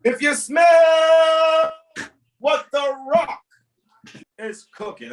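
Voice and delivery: huge drawn-out high yell; playful over-the-top bellow, stretched long; wrestling catchphrase shout. Pretend. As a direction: belt it out like a wrestling hype man. Mock wrestler catchphrase outburst.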